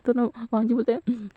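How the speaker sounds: plain reading voice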